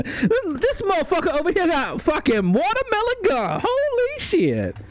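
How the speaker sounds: hammed-up high caricature of an amazed onlooker. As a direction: squeal it in a silly caricature voice, then stretch out holy shit. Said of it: "You immediately become the".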